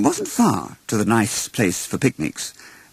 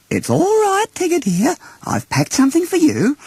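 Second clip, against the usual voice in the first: Kanga; 3 dB louder than usual. Acted. Kanga gently reassures Tigger, high motherly voice. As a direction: coo it like a fond mother to her joey.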